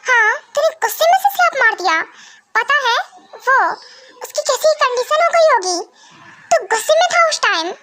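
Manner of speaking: mother's voice up high, shocked and scolding. Acted. Mother scolds son for slapping in anger, high and shocked. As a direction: scold him for hitting someone.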